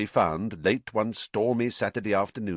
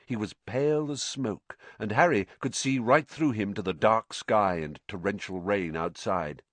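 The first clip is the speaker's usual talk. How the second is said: ordinary delivery, nothing marked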